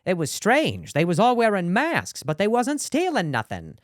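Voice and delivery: high, slow, puzzled cop voice; detective voice. Pretend. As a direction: play a clueless detective. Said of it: "they'd be like".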